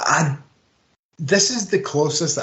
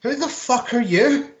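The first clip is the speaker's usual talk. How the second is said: exasperated; 10 semitones higher than usual. Retold or imitated. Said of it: "we're like".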